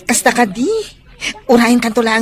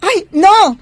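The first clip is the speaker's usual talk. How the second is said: sharp alarmed exclamation, higher and louder; alarmed cry; 7 semitones higher, 5 dB louder than usual. Acted. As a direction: cut him off in alarm. Alarmed reply to death threat.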